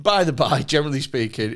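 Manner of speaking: normal talking voice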